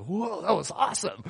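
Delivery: laughing through a high whoa; amazed and giddy, laughing it out; laughing amazement. Retold or imitated. Hiker right after the doctors pop his shoulder back, laughing.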